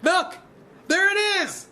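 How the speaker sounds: very high, loud shout. Acted. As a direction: call out to the others. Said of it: "men started to shout".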